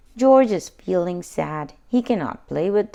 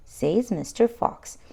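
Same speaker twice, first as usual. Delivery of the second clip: ordinary delivery, nothing marked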